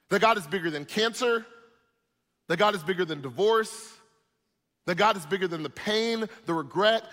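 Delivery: loud, high preacher's cry; full-force preaching. Acted. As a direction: preach it out to the whole church.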